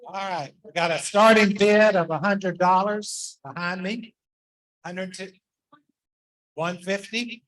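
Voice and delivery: auction caller voice; auctioneer. Acted. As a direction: call out bids like an auctioneer. Runs a charity auction.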